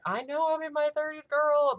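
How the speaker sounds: high, loud, excited protest; worked-up retort. Retold or imitated. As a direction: push it up high and loud at a friend. Acts out her excited retort to friend.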